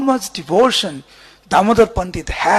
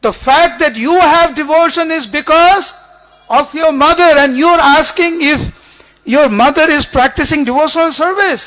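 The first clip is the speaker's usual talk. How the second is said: loud, higher, indignant scolding; furious rebuke; 5 semitones higher, 5 dB louder than usual. Retold or imitated. Damodar Pandit rebuking Mahaprabhu about his mother, furious and loud. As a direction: scold the Lord in outrage, voice raised high. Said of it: "Damodar Pandit was furious".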